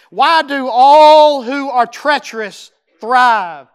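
slow, loud and drawn out; preacher thundering Jeremiah's complaint; loud lament. Acted. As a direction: cry the question to heaven.

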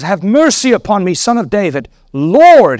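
acted cry for mercy, lifted in pitch. A narrator's reading. Gentile woman pleads with Jesus, crying out. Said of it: "she cried out".

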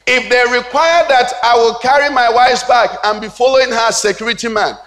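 high, driving pulpit delivery; forceful preaching. Real, not acted. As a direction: preach it hard, building to an emphatic cry.

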